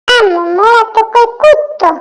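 ordinary talk, nothing marked

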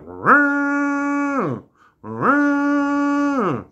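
long, high, held horn blare; horn blast imitation. Man imitating the horn Marge pulled out, high held blare. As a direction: blow a drawn-out conch call with the voice. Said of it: "this noise went off, went".

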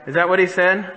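ordinary delivery

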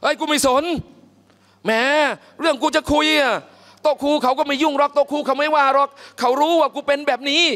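high, brash, careless loudmouth; brash loud voice. Retold or imitated. Preacher playing Thabit ignoring the teacher, loud and brash. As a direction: toss it off like you don't care who hears. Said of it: "ซาบิตเป็นคนเสียงอย่างนี้แหละ".

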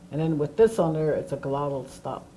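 ordinary talk, nothing marked

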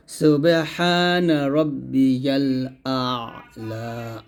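আ'লা with pressed thin ain, very slow; model recitation. Shown as a demonstration. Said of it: "আইনের উচ্চারণটা একটু চাপ দিয়ে চিকন করে". (ধীরে, আইন চাপ দিয়ে চিকন)

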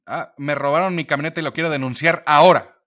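loud, urgent outraged complaint; wife loudly reporting her stolen truck. Retold or imitated. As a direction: demand action at once. Wife reporting theft to officials.

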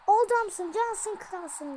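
loud, high cheer; excited praise. Real, not acted. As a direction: shout praise at your striker. Praising the scorer.

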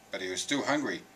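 plain reading voice

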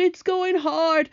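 slow, high miserable whine; moaning. A narrator's reading. Centipede stuck in drying paint, moaning. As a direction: drag the words out in a high moan. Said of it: "he moaned".

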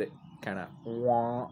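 slow drawn-out imitated goose wah; goose noise. The dead goose's neck making a bagpipe goose noise. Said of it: "made a goose noise".

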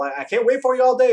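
high, loud impatient call; shouting. A narrator's reading. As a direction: call out loudly to stragglers. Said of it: "he shouted from the dark hole".

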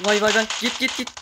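loud, high startled cry; startled shout. Real, not acted. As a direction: yelp it out in panic. Surprised by enemy in fight. (yüksek ve telaşlı bağırış)

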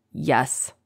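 mild choked gurgle; a touch of strangling on the word; gurgled. A narrator's reading. Choked captive admits she came to kill, mild gurgle. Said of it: "gurgled Karis".

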